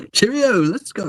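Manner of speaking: high-pitched British caricature; mock British accent. Joining the joke about British people, high British accent. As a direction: chirp it as a cartoon Brit. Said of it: "British people are like".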